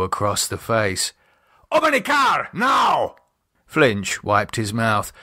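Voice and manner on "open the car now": furious shout. Acted. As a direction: yell it, full fury. Guard shouts after striking Flinch.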